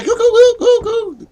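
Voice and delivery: put-on very high, silly baby babble; cooing at the chat like a baby; mock baby goo goo. Streamer goofing to chat in high baby coos.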